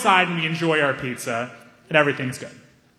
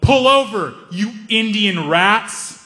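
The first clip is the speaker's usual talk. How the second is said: mock yell; 6 semitones higher, much slower than usual. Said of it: "he yelled".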